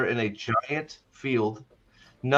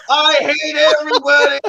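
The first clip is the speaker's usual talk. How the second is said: high, loud hammed-up tantrum; over-the-top raging scream; mock furious yell; 13 semitones higher, 11 dB louder than usual. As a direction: shout it as an exploding outburst. Acts out Nynaeve's explosive rage.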